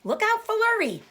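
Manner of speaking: very high cow warning call. Acted. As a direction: warn from across the field. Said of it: "called the cows".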